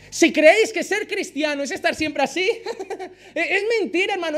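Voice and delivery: real laughter through the words; laughing. Real, not acted. Preacher mocking always-happy Christians, laughing. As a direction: laugh at the idea while speaking.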